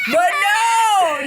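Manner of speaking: high, loud laughing cry; laughing shout. Real, not acted. Venting at friends about being roasted, loud laughing shout.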